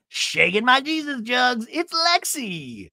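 high, slow, sultry tease; mock showgirl intro. Host mocking Lexi's rebrand, slow high tease. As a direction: purr it like a stage act.

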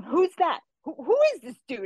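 high, loud mock demand to know; imitating a baffled instructor, pitched well up; incredulous questioning. Instructor asking student who the newcomer is, incredulous.

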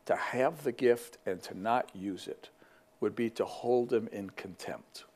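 slow, hushed Scottish conviction, near whispered; a light Scottish brogue, slowed right down and soft; Eric Liddell's Scottish brogue. Retold or imitated. Eric tells his sister Jenny why he runs, in a slow, hushed Scottish brogue. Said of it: "some of you could do a better Scottish brogue than me".

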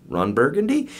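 rising question tune on BURGUNDY; "Burgundy" swept upward like a question mark; Ron Burgundy. Shown as a demonstration. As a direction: read the name as a question, climbing high on Burgundy. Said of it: "with question marks".

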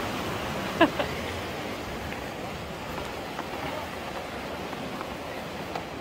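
long, soft, airy giggle; breathy laugh. Real, not acted. Angler giggling softly at the thrashing fish, airy laugh. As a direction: giggle quietly through the struggle.

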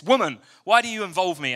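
abrupt, rude son brushing his mother off; disrespectful. Retold or imitated. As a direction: snap it back like a rude retort. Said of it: "I used to think this was really disrespectful".

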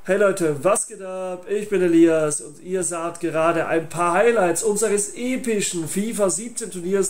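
usual speaking voice

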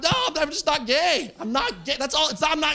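high, hammed-up protest; mock wailing. Comic protesting to Emilio from afar, melodramatic wail. Retold wailed denial at the moon.

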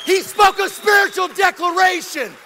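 loud, high preacher's proclamation; triumphant preaching. Real, not acted. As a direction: proclaim the miracle at full force. Preaching at full force.

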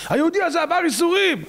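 very high, emphatic proclamation; retold advocate's high-pitched appeal; insistent angel's plea. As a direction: cry out in his defence. Angel repeats plea more insistently.

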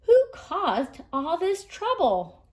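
mildly indignant storybook animals; cross demand. Acted. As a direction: demand to know who is to blame. Cranky animals looking for blame.

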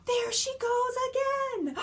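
high, thrilled exclamation; excited squeal. Acted. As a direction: squeal it with delight. Excited rhyme climax.

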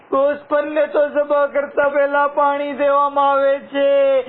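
high, loud mourning sermon, building in grief; impassioned majlis preaching. Acted. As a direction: cry out the martyrdom to the mourners, rising. Mourning sermon builds to wailing pitch. (ઊંચા, ગમગીન અવાજે મજલિસનો બયાન)

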